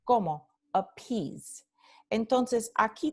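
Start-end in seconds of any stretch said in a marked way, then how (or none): 0.7-1.6 "appease" with aspirated p, stressed second syllable; aspirada. Shown as a demonstration. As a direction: puff the p into a stressed "-pease" in "appease". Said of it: "tenemos la aspiración". ("appease" con p aspirada y acento final)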